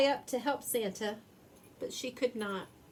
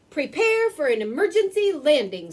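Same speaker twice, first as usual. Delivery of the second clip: louder, higher command; firm instruction; 6 semitones higher, 8 dB louder than usual. A narrator's reading. Santa instructs the reindeer to land, louder. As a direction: order the team down. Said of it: "Santa instructed".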